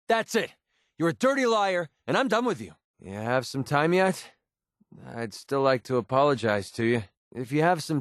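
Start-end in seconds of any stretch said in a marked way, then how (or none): 0.1-2.7 high-pitched, heated accusation; angry outburst. Acted. Cutting off a liar for good, angry. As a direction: break it off in a temper. Acted quarrel, breaking off.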